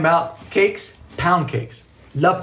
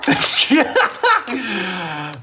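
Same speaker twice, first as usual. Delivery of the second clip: high helpless laugh; real laughing fit; 5 semitones higher than usual. Real, not acted. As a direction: crack up mid-stream. Cracks up at own attempts.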